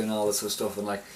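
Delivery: ordinary talk, nothing marked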